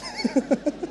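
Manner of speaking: a sudden squeaky giggle; high laugh. Real, not acted. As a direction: burst of high-pitched laughter. Laughing at mother's odd request.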